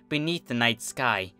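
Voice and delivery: ordinary delivery, nothing marked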